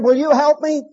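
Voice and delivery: high-pitched retold plea; pleading call. Fallen man asking God for help, pleading call.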